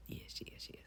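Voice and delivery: breathy whispered yeses; hushed, secretive yes yes yes. Real, not acted. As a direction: whisper it close to the mic. Whispered aside.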